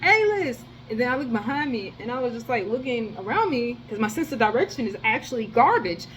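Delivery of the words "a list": high sing-song call of A-List; imitating someone calling after you; mock call-out. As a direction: call out the name teasingly, much higher. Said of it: "But they said".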